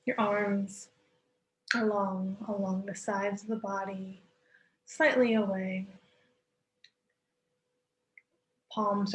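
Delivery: calm yoga nidra voice; guided meditation. Acted. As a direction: ease listeners into stillness, unhurried. Leads guided relaxation with long pauses.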